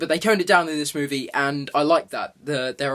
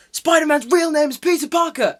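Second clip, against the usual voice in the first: high, hammed-up cry of exposure; villain's dramatic reveal; 10 semitones higher, 4 dB louder than usual. Villain exposing the hero to everyone, dramatic reveal. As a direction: blurt it out high as a villain's big revelation. Acts Mysterio revealing the secret identity.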